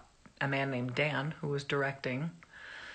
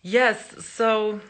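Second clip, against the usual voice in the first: normal talking voice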